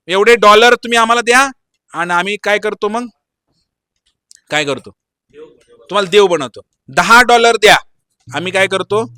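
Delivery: loud, lightly acted huckster guru; a hint of a salesman, projected loudly; mock guru sales pitch. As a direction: hawk godhood like a paid service. Acting fake gurus selling godhood.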